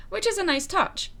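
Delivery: normal talking voice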